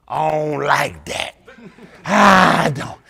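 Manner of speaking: loud, slow, whiny mock gripe; mock complaining. Critic griping about the lady, loud mock whine. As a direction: whine it loudly like a sour critic.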